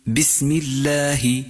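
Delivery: بسم الله recited slowly with a clear kasra; slow, measured recitation of بسم الله; careful recitation. Shown as a demonstration. Teacher recites بسم الله slowly as the model. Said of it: "ولكن قل باسم".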